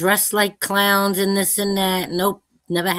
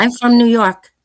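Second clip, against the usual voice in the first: Brooklyn accent; 5 semitones higher, 5 dB louder than usual. Real, not acted. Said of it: "That's why you got that accent".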